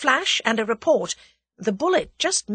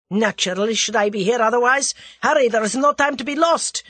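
loud, raised-pitch urging; urgent insistence; 5 semitones higher, 6 dB louder than usual. A narrator's reading. The Russian hurries Whittington along, urgent and insistent. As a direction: insist forcefully that there's no time. Russian urges his accomplice to hurry.

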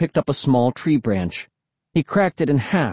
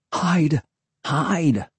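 pressing, slightly raised pitch; urging; 4 semitones higher than usual. A narrator's reading. Boy urging his exposed friend to hide, pressing. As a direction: urge it in a contained voice. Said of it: "Will urged".

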